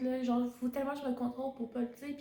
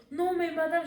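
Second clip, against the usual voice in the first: whiny student protest; 6 semitones higher, 6 dB louder than usual. Retold or imitated.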